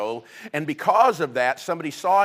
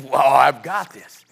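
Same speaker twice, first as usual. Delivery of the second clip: louder, slow boastful show-off; proud boy; 9 dB louder, slower than usual. Retold or imitated. David carrying Goliath's head around, boasting loudly. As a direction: gloat like a kid with a trophy.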